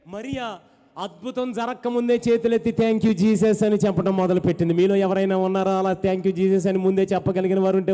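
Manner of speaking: loud fervent preaching call; full-force preaching. Real, not acted. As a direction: rouse the congregation to shout praise. (గట్టిగా ఉత్సాహంగా బోధించడం)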